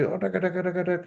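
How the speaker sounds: nagging wife noise. Retold or imitated. Wife nags the husband again, mock scolding babble. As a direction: rattle off the wife's nagging as noise. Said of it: "Ngomel lagi".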